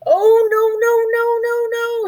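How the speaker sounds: high, crying no no no; a tiny mouse crying out in fear; frightened squeak. A narrator's reading. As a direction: squeal in rising panic. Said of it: "squeaked Little Mouse".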